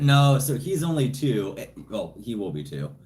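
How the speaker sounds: usual speaking voice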